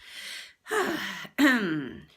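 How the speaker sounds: big exasperated sigh; a loud sigh after fumbling the line; loud frustrated sigh. Real, not acted. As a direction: huff out the frustration loudly. Frustrated at flubbed take.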